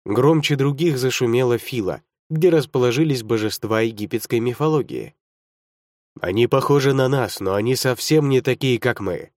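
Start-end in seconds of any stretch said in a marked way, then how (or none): none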